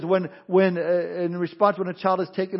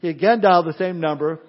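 ordinary talk, nothing marked

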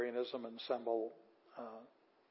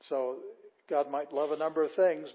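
ordinary talk, nothing marked

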